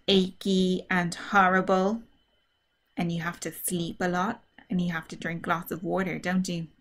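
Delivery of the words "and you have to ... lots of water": ordinary delivery, nothing marked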